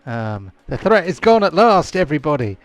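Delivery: high, loud jubilant elf voices; excited elves. Acted. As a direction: cheer like a relieved crowd. Elves celebrate the Devil's Mouth gone.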